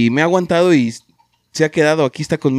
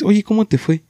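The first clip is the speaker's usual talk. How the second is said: a lively, higher-pitched "cómo te fue"; interested ask; 7 semitones higher than usual. Retold or imitated. Boyfriend asking his partner about her day, brightly interested. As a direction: ask it like you really want to know.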